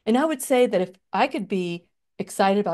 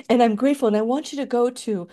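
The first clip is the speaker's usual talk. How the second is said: ordinary talk, nothing marked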